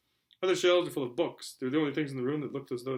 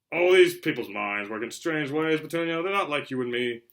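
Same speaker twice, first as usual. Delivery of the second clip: pompous uncle; 7 dB louder than usual. Acted. Vernon lecturing his wife.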